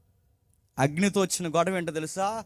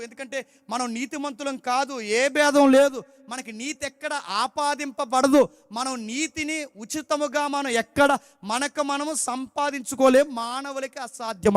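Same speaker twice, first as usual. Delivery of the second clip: preaching; 6 semitones higher, slower than usual. Acted. Preaching at full force.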